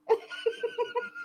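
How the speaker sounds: shrill burst of laughter; high squealing laugh. Real, not acted. Host laughs at co-host's slip, squealing laugh.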